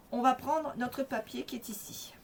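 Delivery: ordinary delivery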